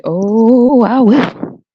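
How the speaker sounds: loud, high, stretched "oh"; emphatic vow. Real, not acted. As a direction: swell into an emphatic promise. Emphatic reply to listener's comment.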